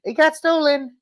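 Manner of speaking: very high put-on squeak; mock-whine an excuse to the boss; whining secretary. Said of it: "she's gotta go to Trump".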